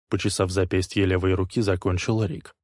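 usual speaking voice

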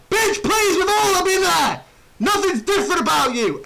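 loud, high-pitched outburst; yelled dismissive retort; exasperated mock rant. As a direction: shout them down in scornful exasperation.

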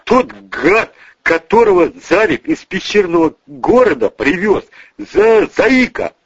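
high, agitated, stumbling report; panicked explaining. Acted. Bodyguard explains who escaped, high and panicky. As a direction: stumble onto the nickname.

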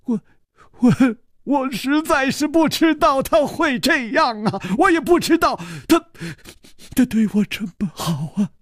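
distressed servant. Acted. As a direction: stumble over the words, upset. Yijian stammers, distressed.

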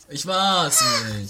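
high, loud, slow denial. Acted.

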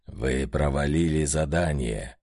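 низкий мрачный голос одержимого; зловеще, медленно, низко; мрачно. A narrator's reading. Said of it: "мрачно сказал одержимый". (мрачный голос древнего одержимого)

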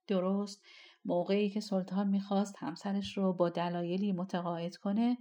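ordinary delivery

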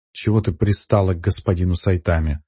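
plain reading voice

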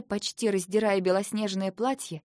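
usual speaking voice

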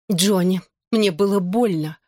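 understated hurt, slightly strangled; choked up. A narrator's reading. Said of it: "сдавленно произнесла она". (немного сдавленно, с обидой)

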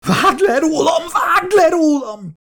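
very high, frenzied screaming; crazed howl. A narrator's reading. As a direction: beg at a deranged scream. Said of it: "üvöltötte térdre rogyva Milburn tébolyult hangon".

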